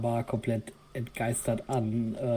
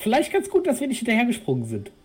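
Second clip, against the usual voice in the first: excited; 11 semitones higher, 5 dB louder, faster than usual. Real, not acted. Relieved, shaken after fall.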